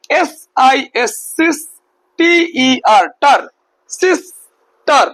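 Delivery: সিস and টার said apart, then sister slow; syllable by syllable. Shown as a demonstration. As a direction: split it into two clear beats. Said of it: "দুটো খণ্ডে". (Syllable ভাগ করে ধীরে)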